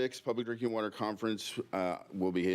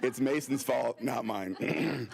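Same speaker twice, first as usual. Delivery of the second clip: laughing; 5 semitones higher, slower than usual. Real, not acted. Blaming Mason after bad joke.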